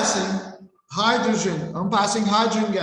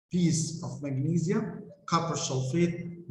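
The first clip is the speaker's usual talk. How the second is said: slow teaching; slower than usual. Shown as a demonstration. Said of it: "teacher re-reads key words slowly".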